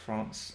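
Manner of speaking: FRANCE with a long open ah; France with an open vowel; British pronunciation. Shown as a demonstration. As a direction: softly echo France with a British ah. Echoes her British pronunciation.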